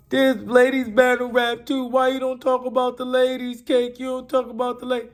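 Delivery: high, loud mock female fans; mimicked complaining ladies. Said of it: "ladies be in my D M like".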